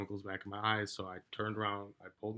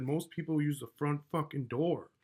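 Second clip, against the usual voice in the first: higher-pitched, retold indignant jab; exasperated comeback; 6 semitones higher, 3 dB louder than usual. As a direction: argue back in disbelief, pitch raised. Acted quarrel, his exasperated comeback.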